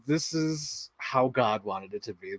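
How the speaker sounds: soft, slow reverent verdict; hushed awe. Retold or imitated. Fan reacting to the film's climax, hushed slow awe. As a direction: say it slowly, quietly, like a revelation.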